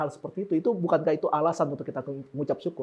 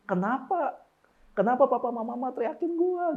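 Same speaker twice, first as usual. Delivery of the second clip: put-on high plaintive child; child's voice; 8 semitones higher than usual. Toddler asking parents why they yelled, high and plaintive. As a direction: ask like a hurt little kid.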